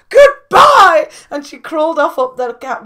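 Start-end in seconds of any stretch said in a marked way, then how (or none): 0.1-1.1 much higher, shouted goodbye; angry goodbye. Acted. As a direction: fling the word as you leave. Caterpillar storms off.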